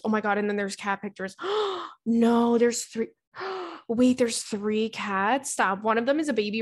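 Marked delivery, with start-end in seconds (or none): 1.4-2.0 high sharp gasp of delight. Real, not acted. Host spotting a listener's cat photos, with a high delighted gasp. Sees cat pictures in letter. 3.4-3.8 high sharp gasp of delight. Real, not acted. Host spotting a listener's cat photos, with a high delighted gasp. Discovers there are three cats.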